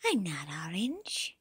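whisper. Acted. Disappointed after looking.

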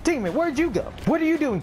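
high-pitched exasperated scolding. Real, not acted. Player asking teammate where they went, exasperated. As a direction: call out the teammate in disbelief.

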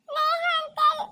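put-on high child's voice, pleading for daddy; scared little girl. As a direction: play a frightened small girl calling her father. Child begs, starts crying. (ಹೆದರಿದ ಪುಟ್ಟ ಹುಡುಗಿಯ ಧ್ವನಿ)

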